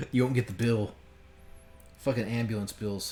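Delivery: normal talking voice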